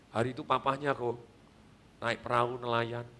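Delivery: hushed dramatic telling. Acted. Dramatic story climax.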